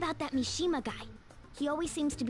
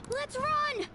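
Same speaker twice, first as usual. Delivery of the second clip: urgent shout; 12 semitones higher than usual. Acted. Caught in uniforms, fleeing.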